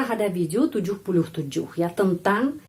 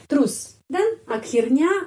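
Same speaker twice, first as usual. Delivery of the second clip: ordinary talk, nothing marked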